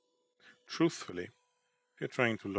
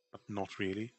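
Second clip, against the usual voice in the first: ordinary talk, nothing marked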